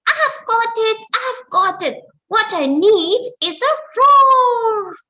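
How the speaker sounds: high, eager squeaky mouse voice; bursting with a bright idea; excited mouse. Acted. Mouse jumping out of bed, excited squeak. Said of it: "out of excitement and said".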